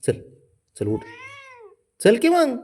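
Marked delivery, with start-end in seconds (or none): 2.0-2.6 high coaxing call. Real, not acted. Coaxing pet, pitch jumps up.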